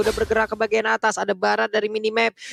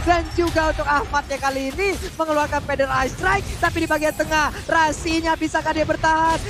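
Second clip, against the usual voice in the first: excited play-by-play; 6 semitones higher than usual. Real, not acted. Live play-by-play of teamfight.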